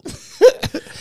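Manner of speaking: loud high laughter; laugh burst. Real, not acted. Storyteller laughing loudly.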